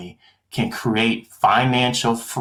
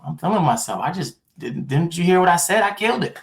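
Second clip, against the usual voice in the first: cocky self-quote; 8 semitones higher, faster than usual. Retold or imitated. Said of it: "I'm like".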